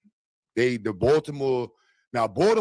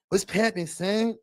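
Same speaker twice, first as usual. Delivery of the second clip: YN voice; 5 semitones higher, slower than usual. Pretend. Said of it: "Do a Y N voice".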